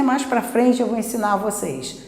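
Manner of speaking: ordinary talk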